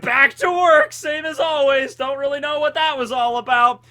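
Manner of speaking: high, loud shout of a cartoon villain. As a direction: yell it in a squeaky villain voice. Caesar voice continued, shouted.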